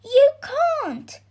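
put-on high, loud boy's outcry; cried protest. A narrator's reading. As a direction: cry out in dismay. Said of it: "cried the boy".

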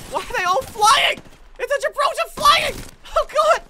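very high, loud alarm; panicked shouting. Real, not acted. Player seeing enemies fly in, panicked shouting. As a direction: shriek in alarmed disbelief.

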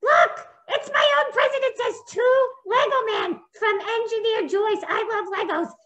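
a toy hero bursting with pride; very high, excited puppet; excited Lego Man. Acted. Lego Man reads gift.

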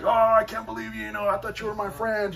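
mock hurt protest. Old friend answering the preacher's teasing, mock hurt protest. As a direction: act wounded and betrayed, playfully overdone.

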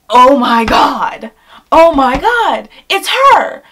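loud, rising excited gasp; drawn-out, loud starstruck exclamation; mock excited shriek. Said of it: "they were really excited".

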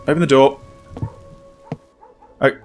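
usual speaking voice

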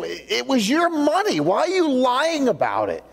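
high, mildly indignant challenge; angry questioning. Acted. Peter confronts Ananias about the money, mildly angry. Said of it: "He's so angry over this".

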